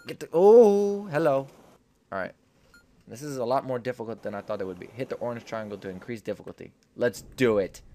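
ordinary delivery

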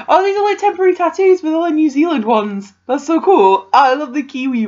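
high and loud, thrilled; excited gush. Real, not acted. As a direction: rave about the tattoos. Unboxing a gift, delighted.

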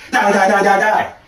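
high, loud laughing chant of die; gleeful shouting. Acted. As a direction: cackle it out while shooting. Gleeful shooting spree.

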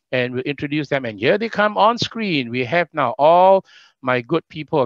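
lifted, energetic host voice; rally the panel on screen with upbeat projection; MC announcer. Real, not acted. Host summoning candidates on screen, projected MC delivery.

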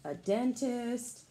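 plain reading voice

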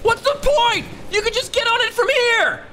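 whiny complaint. Real, not acted. Complaining at game's path.

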